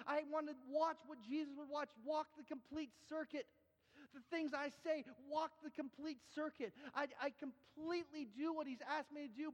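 high, insistent sermon delivery; impassioned preaching. Real, not acted. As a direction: exhort the congregation with rising urgency. Preacher exhorts congregation.

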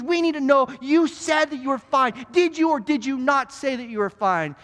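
high, impassioned cross-examination; passionate lawyer. Acted. Defense lawyer cross-examining the old man in court, passionate. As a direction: hammer the witness with rising fervor. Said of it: "He starts getting passionate in the courtroom".

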